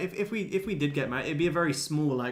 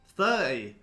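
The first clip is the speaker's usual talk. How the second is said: loud, disbelieving exclamation; incredulous outburst; 8 dB louder than usual. Real, not acted. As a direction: blurt it out in disbelief at the guess. Reacting to viewers' age guesses.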